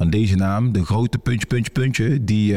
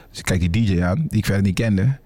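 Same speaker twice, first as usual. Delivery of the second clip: normal talking voice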